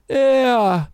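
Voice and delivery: high hoarse call; hoarse shout. A narrator's reading. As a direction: rasp out the name. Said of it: "мой голос хрипел".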